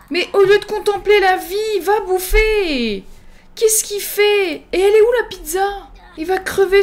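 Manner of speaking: whine-shout it, stretching the endings; exasperated wail. Pretend. Player scolds a Sim who will not eat, exasperated wail.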